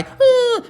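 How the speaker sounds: very high mock wail; hammed-up, high-pitched fake sob; fake cry. Pretend victim deciding she better cry, fake high wail. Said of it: "I better cry".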